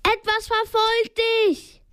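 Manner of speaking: high-pitched warning call; storyteller's urgent cry; yelled warning. A narrator's reading. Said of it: "brüllte er".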